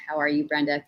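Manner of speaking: ordinary talk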